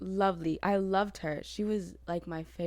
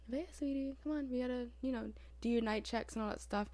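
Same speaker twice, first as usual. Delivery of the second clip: gentle, higher sing-song coaxing, kept soft; soft-spoken nurse; 6 semitones higher, 4 dB quieter, faster than usual. Retold or imitated. Night nurse gently waking her patient for checks, soft-spoken. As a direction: imitate a sweet night nurse waking someone. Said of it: "you know, like, soft-spoken".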